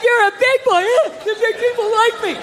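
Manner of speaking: put-on high patronizing baby talk; mock praise for a child. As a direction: coo at him like a toddler.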